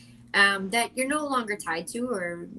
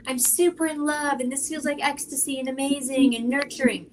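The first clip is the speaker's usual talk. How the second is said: gushing; 5 semitones higher than usual. Retold or imitated. Acting out blissful feeling.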